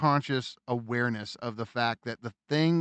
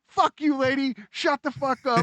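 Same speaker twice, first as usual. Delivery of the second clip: mock angry yell; 11 semitones higher, 3 dB louder than usual. Both men yelling at lady.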